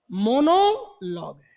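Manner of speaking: "मोनोलॉग" drawn out slowly; slow word. Shown as a demonstration. Teacher answering his own question, slowly. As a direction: stretch "मोनोलॉग है" out. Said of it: "ये क्या है".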